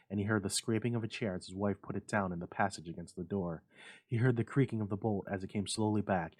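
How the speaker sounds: ordinary delivery, nothing marked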